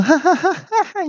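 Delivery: 尖高的哈哈大笑; 放声嘲笑对手; 高声大笑. A narrator's reading. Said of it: "我就大笑说". (高亢的哈哈大笑)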